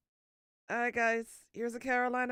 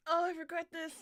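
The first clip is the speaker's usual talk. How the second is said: normal talking voice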